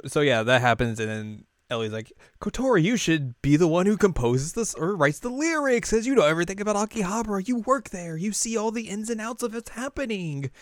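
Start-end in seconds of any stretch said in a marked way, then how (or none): 2.4-10.5 high, quick pushy voice of Ellie. Retold or imitated. Ellie urges Kotori to write the lyrics in a high, eager voice. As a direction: coax her eagerly.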